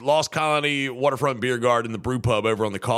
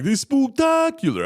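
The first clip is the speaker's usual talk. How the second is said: high, loud mock vampire line; hammed-up spooky vampire; 12 semitones higher, 4 dB louder than usual. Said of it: "I remember I was like".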